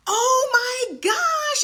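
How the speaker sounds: high, loud, stretched-out cry of joy; overjoyed shout. Real, not acted. Host reacting live to a client's win, joyful shout. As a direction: squeal it, slow and loud, at the happy news. Reading a client's big news.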